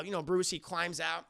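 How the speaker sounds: usual speaking voice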